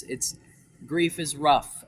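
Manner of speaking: ordinary talk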